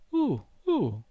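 a high, stunned little squeak; baffled uh uh. A narrator's reading. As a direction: squeak it out, completely at a loss. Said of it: "per una seconda volta". (uh uh sconcertato, acuto)

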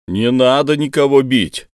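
громкая умоляющая просьба; взмолиться, повысив голос; мольба. Acted. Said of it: "взмолился Инсаров". (умоляюще и громко)

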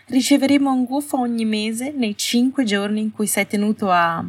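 high, manic edge to every phrase; brittle, half-laughing disbelief. Acted. Astoria mocking the arrangement in front of Hermione, manic and high. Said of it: "Astoria rise un po' maniacalmente".

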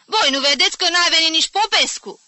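loud, high furious question; booming, exasperated scolding; thundering rebuke. A narrator's reading. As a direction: shout them down in outrage. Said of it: "tună de asta dată vocea".

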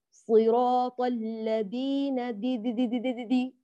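blur the consonant of الذين between th and D; slow recitation, then repeated muddled دي syllables; mixed dhal-dal sound. Shown as a demonstration. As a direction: demonstrate دي as neither clean dhal nor clean dal. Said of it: "الصوت مختلط".

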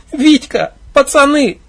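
high, tearful plea; on the verge of crying; girl about to cry; near tears. Acted. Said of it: "Чуть не расплакалась девушка".

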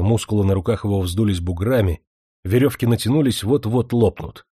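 ordinary delivery, nothing marked

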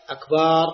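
أكبر with the ba stretched into a long baa; wrong long ba in أكبر; drawn-out ba. Shown as a demonstration. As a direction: hold the ba of أكبر far too long. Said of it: "ولا تمد الباء".

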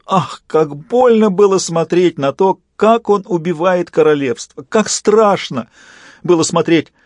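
high, drawn-out mock agony; theatrical fake torment; feigned anguish. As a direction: put on belated suffering, hammed up. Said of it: "запоздало изобразил муку в голосе".

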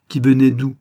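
loud, high interrogating question; brusque master's question; sharp demand. A narrator's reading. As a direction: cut in and demand an answer.

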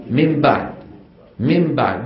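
من بعد said as ممبعد, n merged into m; n turned to m. Shown as a demonstration. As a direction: say من بعد with an m before the b. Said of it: "يقلبون النون إلى ميم فيقولون". (انطق من بعد بالميم: مم بعد)

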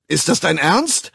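high, loud disbelief; can't believe the answer; incredulous shout. A narrator's reading. Bernard shouting his disbelief at John, high and loud.

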